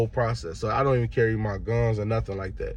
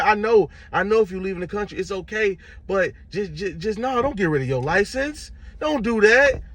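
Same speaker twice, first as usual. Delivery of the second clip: high mock pleading voice; a high imitated clerk begging him not to; mock high-pitched clerk pleading; pleading worker; 10 semitones higher, 4 dB louder than usual.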